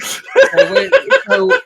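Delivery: squealing high through laughter; laughing. Real, not acted. Host laughing at his own rule, high squeal.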